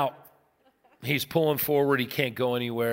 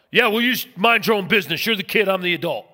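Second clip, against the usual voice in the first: quick, raised-voice parental brush-off; defensive parent pulling rank, louder and faster; dismissive parent retort; 5 semitones higher, 5 dB louder, faster than usual. Acted. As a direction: shut the kid down briskly. Parent snapping at child, acted.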